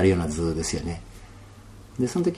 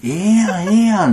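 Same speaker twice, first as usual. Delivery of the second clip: higher, louder Kansai voice of the stars, soothing; Kansai-dialect star voice; 10 semitones higher, 6 dB louder than usual. Retold or imitated. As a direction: play the stars reassuring him in Kansai dialect. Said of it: "関西弁や".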